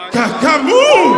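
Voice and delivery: slow loud high monster name; mock scary announcement; dramatic shout. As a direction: boom the name to scare. Naming the dreaded monster.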